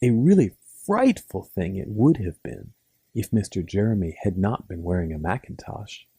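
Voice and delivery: ordinary delivery, nothing marked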